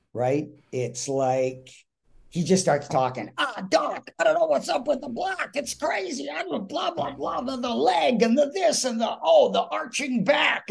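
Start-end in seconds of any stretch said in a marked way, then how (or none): 3.4-10.7 very high, put-on voice barrelling through nonsense; rambling Mickey Rooney. Mickey launches into an unwritten speech, high and rambling. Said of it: "Mickey just launches into some speech".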